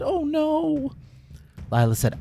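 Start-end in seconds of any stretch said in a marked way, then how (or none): 0.0-0.9 much higher creature voice; small worried gasp of dismay; Bramble dismayed. Acted. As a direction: sidekick seeing his friend not breathing. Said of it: "And Bramble said".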